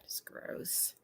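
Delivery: whispered in disgust. Real, not acted. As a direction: breathe it out, repulsed. Quiet disgusted aside.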